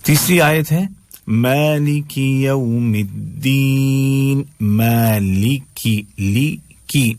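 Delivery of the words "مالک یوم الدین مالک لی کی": "مالک" slow, as maa-lee-kee; clear ee vowels. Shown as a demonstration. Teacher modelling the third verse, slow recitation with ee vowels. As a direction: stretch "مالک یوم الدین" in slow measured recitation. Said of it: "ای کی آواز آ رہی ہے".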